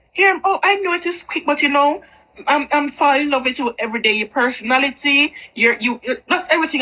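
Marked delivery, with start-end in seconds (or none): none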